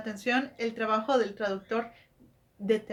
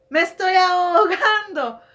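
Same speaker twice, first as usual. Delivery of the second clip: high, loud, slow cry of "ahogando", hammed-up; mock drowning cry; 7 semitones higher, 8 dB louder, slower than usual. Drowning cartoon character, a hammed-up high loud cry. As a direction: wail it like a character going under water.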